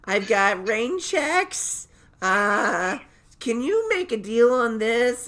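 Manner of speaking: high, slow, drawn-out mom voice; hammed-up pushy customer, loud and stretched; mom at checkout. Mom haggling in his checkout aisle, mock high-pitched mom voice. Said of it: "my mom goes through my checkout aisle".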